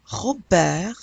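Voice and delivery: "Robert" as ro-BEHR, throaty first R, silent T; French "Robert" with a barely heard final R; French wet and dry R. Shown as a demonstration. Teacher repeats for learners, French "Robert" ro-BEHR. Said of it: "it starts off with a wet R".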